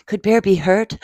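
ordinary delivery, nothing marked

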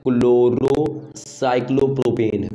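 क्लोरोसाइक्लोप्रोपेन drawn out very slowly, part by part; slow spelled-out naming. Shown as a demonstration. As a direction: break क्लोरोसाइक्लोप्रोपेन into slow chunks for the class. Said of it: "देखो बेटा क्या हो जाएगा". (क्लोरोसाइक्लोप्रोपेन को धीरे-धीरे, टुकड़ों में बोलिए)

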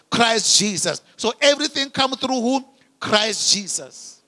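loud, declamatory sermon delivery, pitch often lifted; full-force preaching. Real, not acted. Preacher declaring righteousness in Christ Jesus to the congregation, full-force preaching.

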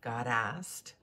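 ordinary delivery

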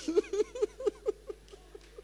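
squealing delighted laugh; high laugh. Real, not acted. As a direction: burst out laughing.

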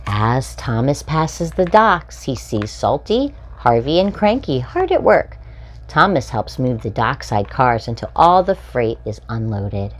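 bright, lively storybook read-aloud; animated children's story reading. A narrator's reading. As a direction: read it like a picture book for little ones. Reading picture book to children.

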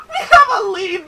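loud, high laughing cheer; laughing shout. Real, not acted. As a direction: crow it with a laugh. Celebrating a clue.

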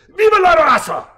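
high, loud battle cry; rallying cry. Acted. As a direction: rouse the crowd with it. Rallying cry, fist raised.

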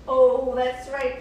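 usual speaking voice

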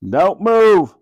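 police voice from the helicopter; megaphone order. A narrator's reading. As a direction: bark it from above. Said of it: "came the voice from the helicopter".

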